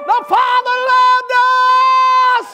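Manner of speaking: loud, high shouted revival preaching; preaching at full force. Acted. Preacher acting out the father and sons, shouted full-force preaching. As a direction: drive the story home to the pews.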